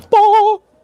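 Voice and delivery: sudden high mock exclamation; high playful "bah". Real, not acted. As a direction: blurt a high silly "bah". Exclamation before opening unit.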